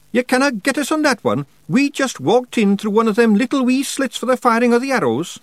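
high, loud Scots character voice; little rogue arguing a technicality; protesting wee Feegle. A narrator's reading. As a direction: defend yourself hotly in high Scots. Rob's character voice defending himself.

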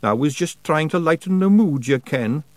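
sheepish Scots. A narrator's reading. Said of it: "looking sheepish".

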